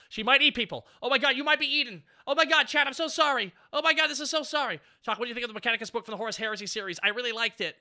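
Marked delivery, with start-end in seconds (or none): 0.1-0.8 alarmed. Real, not acted. Panics over game character. 1.0-4.8 higher and louder, frantic oh my god; panicked apology. Real, not acted. Panics as character is eaten.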